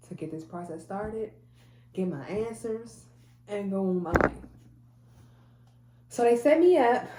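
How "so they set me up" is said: excited. Real, not acted. Excited surgery finally scheduled.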